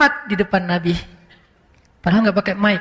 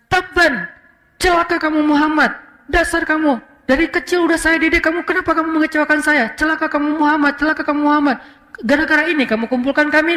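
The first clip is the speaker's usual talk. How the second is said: higher, cursing tirade at his nephew; furious outburst; 5 semitones higher than usual. Retold or imitated. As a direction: rage at Muhammad in front of everyone. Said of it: "Dia habis-habisan sampai wajahnya merah". (Abu Lahab memaki dengan marah)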